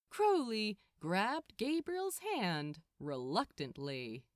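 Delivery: a tour guide rallying his charge; Gabriel, brisk. Acted. Gabriel hurrying Crowley along, brisk.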